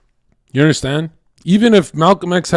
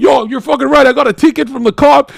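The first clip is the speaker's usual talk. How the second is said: much higher, louder fired-up voice; pumped-up fan; 12 semitones higher, 6 dB louder than usual. Retold or imitated. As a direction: sound totally charged up by the speech. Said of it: "I'd be like".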